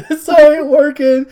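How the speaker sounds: giggling imitation of the guy. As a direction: laugh while quoting him. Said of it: "One of the guys is like".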